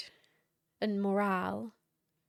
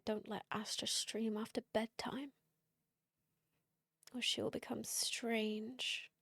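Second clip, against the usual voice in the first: whisper; 6 dB quieter than usual. Pretend. Spooky warning about late streams.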